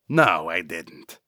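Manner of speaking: low, slow character denial; flustered denial. Acted. Vernal denies the accusation.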